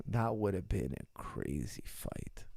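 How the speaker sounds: soft, slow half-whisper; awed hush; hushed aside. Real, not acted. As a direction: drop to a whisper in awe. Awed aside after fight matchup.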